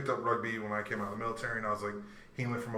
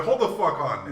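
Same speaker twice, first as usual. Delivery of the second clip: louder, higher retort; mock protest; 4 semitones higher, 9 dB louder than usual. Player defending a pass to a teammate, mock protest. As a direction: cut in loudly to object.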